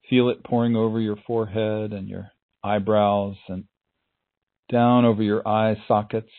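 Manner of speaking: calm, unhurried meditation voice; guided meditation. Acted.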